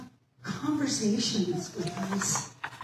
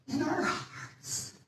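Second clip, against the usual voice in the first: tearful; 7 semitones higher, 6 dB quieter, slower than usual. Real, not acted. Speaker tearing up mid-sermon.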